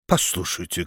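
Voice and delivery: мягко и тише, по-дружески; тихо и нежно, успокаивая; ласково. A narrator's reading. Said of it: "ласково вмешался бургомистр". (бургомистр мягко унимает барона: ласково и тише)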